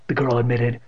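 plain reading voice

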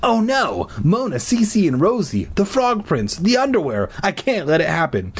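high, mounting alarm; panicked dread. A narrator's reading. Boy foresees underwear humiliation as doorbell rings, panicked. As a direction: gasp it out in high-pitched horror.

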